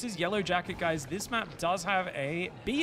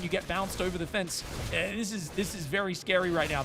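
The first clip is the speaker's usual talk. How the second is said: higher, energetic caster voice calling the fight; live play-by-play; 5 semitones higher than usual. Acted. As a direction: call the action live, pushing the energy.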